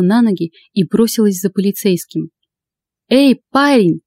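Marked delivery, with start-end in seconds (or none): 3.1-4.0 mild shout, pitched up; understated call after someone. A narrator's reading. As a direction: hail someone in a higher voice. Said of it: "закричала я".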